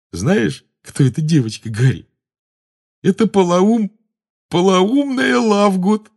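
high, drawn-out teasing that bursts into laughter; giggling. A narrator's reading. Addled Ron confides Luna's nickname to Harry, giggling. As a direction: build to a daft cackle. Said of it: "всё ещё слабо хихикая".

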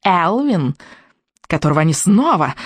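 witch voice with a vile note; nasty witch. Acted. Witch presenting her son, nasty note. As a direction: gloat nastily, like a witch. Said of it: "в её голосе послышалась особенно гнусная нотка".

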